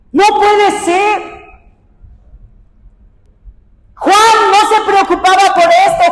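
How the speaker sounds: high, forceful preacher's voice; impassioned preaching. Real, not acted. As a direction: press the point on the congregation. Preaches in raised voice.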